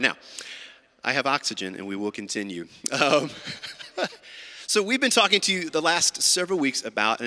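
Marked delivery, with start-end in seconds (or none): 2.9-4.6 breathy laugh. Real, not acted.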